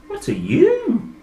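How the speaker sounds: high startled gasp. Acted. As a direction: gasp it out in shock. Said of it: "gasped Percy".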